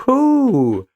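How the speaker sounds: high, loud cheering whoop; excited whoop. Real, not acted. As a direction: whoop with delight. Cheering after chapter.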